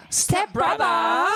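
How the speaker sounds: high, slow Aussie-style stepbrother; mock Australian accent. Echoing the audition in mock Australian accent. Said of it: "Sounds very Australian".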